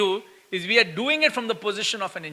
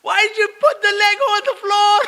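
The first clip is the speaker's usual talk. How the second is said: yelling at his son over Legos on the floor; shrieked parental scolding; scream; 11 semitones higher than usual. Retold or imitated. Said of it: "and then I'm screaming".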